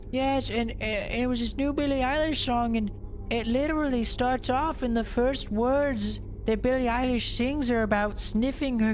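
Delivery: put-on high prim old-lady voice, shocked; mimicking mom. Son mimics mom's horror at sister's music, high and prim. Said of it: "And she's like".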